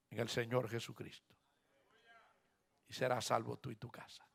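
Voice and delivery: very soft and low; low, quiet assurance; hushed promise. Acted. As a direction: drop right down to a solemn hush. Hushed promise after loud "Cree.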